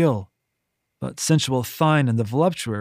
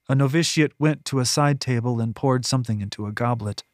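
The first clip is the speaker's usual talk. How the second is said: usual speaking voice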